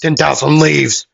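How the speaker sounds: high, loud shouted move call; yelled attack name. Retold or imitated. Midoriya diving down, yelling his attack name. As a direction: call out the attack like an anime hero. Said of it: "he would yell out".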